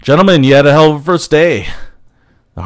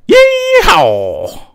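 cowboy yeehaw. Acted. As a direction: whoop like a cowboy. Buzzing the tower.

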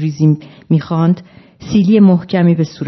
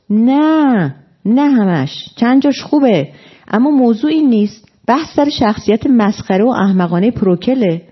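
high, swooping protest; exasperated objection; 6 semitones higher than usual. Acted. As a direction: object with pitch well raised, as if pleading a point. Acted backpedalling in a quarrel.

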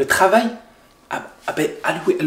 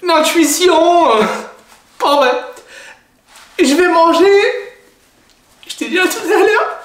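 sarcastic; 10 semitones higher, 5 dB louder than usual. Acted. Wife's sarcastic remarks.

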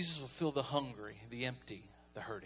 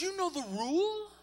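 mom voice; 14 semitones higher, 3 dB louder than usual. Retold or imitated. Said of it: "She goes".

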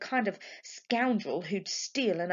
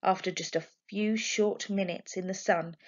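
ordinary delivery, nothing marked

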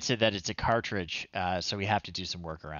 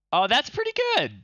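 much higher and louder, a delighted exclamation; impressed surprise; 5 dB louder, faster than usual. Real, not acted. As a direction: let the picture amaze you. Picture appears on screen.

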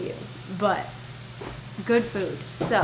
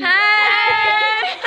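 high excited hey; excited shout; 9 semitones higher than usual. Real, not acted. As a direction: shout a bright greeting. Greeting new friends to camera.

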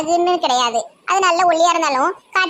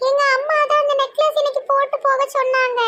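put-on high-pitched girlish character voice; coy young woman; 9 semitones higher than usual. Munis telling Siva about her necklace, coy and high-voiced. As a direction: answer a compliment in a high, coy voice. Munis answers Siva's compliment.

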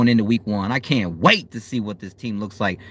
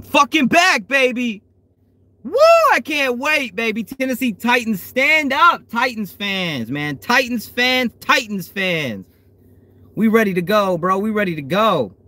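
hype; 9 semitones higher than usual. Real, not acted. Hyping Titans fans.

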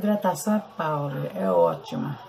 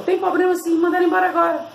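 higher, louder bossy demand; man's stern order; 10 semitones higher, 4 dB louder, faster than usual. Retold or imitated. Retelling how the boss ordered her fired, higher bossy demand. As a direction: snap it out like the angry boss.

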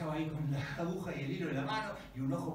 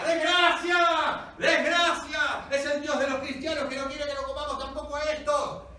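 hysterical shouting; 11 semitones higher, 11 dB louder than usual. Acted. Tribesmen panicking over a bad omen, hysterical shouting. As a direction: wail it out at full volume. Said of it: "hasta que estalla la histeria".

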